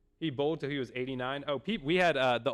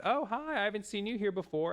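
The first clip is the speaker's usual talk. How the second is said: sweet customer; 9 semitones higher than usual. Retold or imitated. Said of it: "plenty of nice people that came up".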